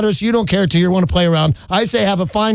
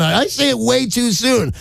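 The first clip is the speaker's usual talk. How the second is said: normal talking voice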